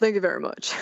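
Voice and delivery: lightly voiced rock-and-roll legend. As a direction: toss off a quick mock stage thanks. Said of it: "I just kept picturing him going".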